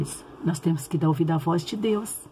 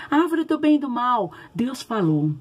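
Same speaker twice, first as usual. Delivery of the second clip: ordinary talk, nothing marked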